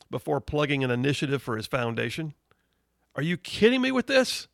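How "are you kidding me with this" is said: incredulous outburst, much higher; exasperated disbelief. Real, not acted. Host reacting in disbelief to Obama's tweet, exasperated and high. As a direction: throw it up in disbelief.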